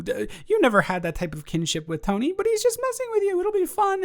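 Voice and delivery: ordinary talk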